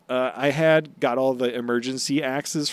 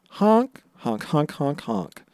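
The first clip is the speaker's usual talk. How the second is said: playful honking like a clown horn; silly clown honks; 4 semitones higher, slower than usual. Pretend. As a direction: honk like a toy clown horn. Clown honks for the Buggy segment.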